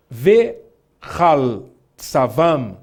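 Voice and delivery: Vehal drawn out slowly with an open A; leitura com A. Shown as a demonstration. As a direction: say "Vehal" very slowly, clear A in the second syllable. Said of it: "como tá escrito aqui". ("Vehal" com A, bem devagar)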